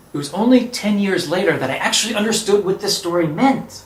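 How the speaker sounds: plain reading voice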